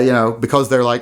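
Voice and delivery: ordinary delivery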